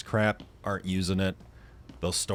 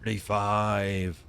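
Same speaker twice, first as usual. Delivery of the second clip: slow drawn-out count; much slower than usual. Shown as a demonstration.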